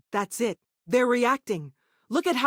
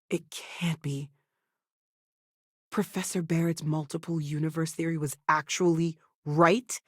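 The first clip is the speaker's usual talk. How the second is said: stunned whisper; 5 semitones lower than usual. Acted. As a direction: breathe it out in shock. Stunned discovery.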